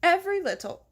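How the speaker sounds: high, loud English accent attempt; trying English accent. Shown as a demonstration. Narrator resetting the mayor's English accent, high and loud. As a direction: punch out a test of the English accent. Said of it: "Let me get the British accent again".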